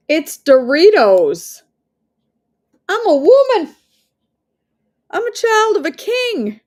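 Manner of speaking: hammed-up high, loud proclamation; drawn-out, playful outburst of defiance; mock-indignant declaration. Woman defying a bag of Doritos, mock-indignant.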